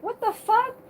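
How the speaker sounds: high-pitched mock indignation; high, incredulous quote of herself; retold startled reaction. Retelling her shocked reaction.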